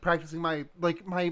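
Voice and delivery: ordinary talk, nothing marked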